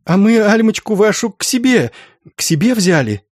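put-on high girlish outpouring; excited gushing. Girl telling Zinaida they took her Alma in, high excited gushing. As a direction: blurt it out high and eagerly.

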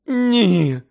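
high, loud anguished moan. Acted. Mother moaning over the body, high and loud. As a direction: groan it out in despair. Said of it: "простогнала місіс Уізлі".

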